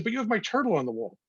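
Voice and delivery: incredulous protest. Retold or imitated. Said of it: "I said".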